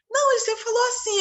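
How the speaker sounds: high, quick naive dad voice; high mock dad voice. Therapist mimics the clueless father, high and quick. Dad answers in retold story.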